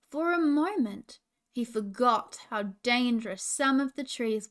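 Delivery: slow, dramatic storybook telling that swells loud; reading a tense story aloud to little ones; story narration. A narrator's reading. Princess reading children a story, slow and building.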